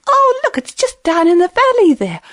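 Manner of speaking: high, encouraging hare voice; high-pitched little animal pointing the way; coaxing hare. Acted. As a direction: egg on someone who has stopped. Hare coaxing the exhausted lion onward.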